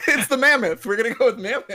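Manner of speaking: chuckling through the words; giggling as he announces it; laughing. Real, not acted. Laughing while announcing pick.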